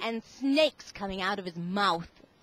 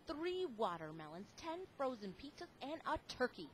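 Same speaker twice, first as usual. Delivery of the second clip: much softer, hushed listing; 14 dB quieter than usual. A narrator's reading. Reading to the kids as Lance raids the fridge, hushed. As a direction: tell it like a secret. Sneaky list of stolen food.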